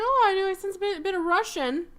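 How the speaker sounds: high, lilting sudden notice; acted realization. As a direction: say it high, as if just catching on. Sally reacting to the accent, acted.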